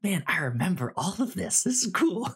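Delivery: retold thought, rising high and bright with delight; excited inner thought. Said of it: "I was just like".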